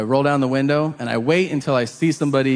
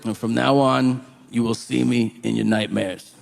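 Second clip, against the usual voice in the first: menacing convict; 4 semitones lower than usual. Acted. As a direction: introduce yourself as a creepy inmate, low and deliberate. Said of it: "Chris is like".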